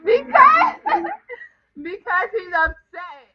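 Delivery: high laughing burst then trailing off; can't stop laughing; giggling through words; laughing fit. Real, not acted.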